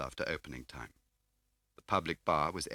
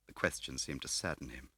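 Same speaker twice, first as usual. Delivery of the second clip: ordinary delivery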